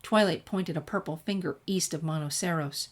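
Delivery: usual speaking voice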